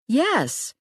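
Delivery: lightly voiced exasperated young woman; Riley's voice, emphatic. A narrator's reading. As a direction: snap back a definite yes.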